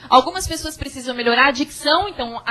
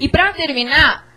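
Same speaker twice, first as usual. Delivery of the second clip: ordinary talk, nothing marked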